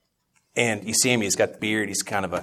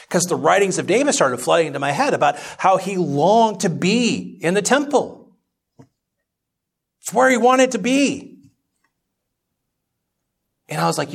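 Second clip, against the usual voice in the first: much higher, louder impassioned sermon; full-force preaching; 10 semitones higher, 6 dB louder than usual. Acted. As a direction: preach it out with building fire.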